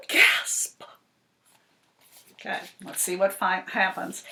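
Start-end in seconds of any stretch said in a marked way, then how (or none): 0.1-0.8 whispered gasp. Pretend. Says the word gasp.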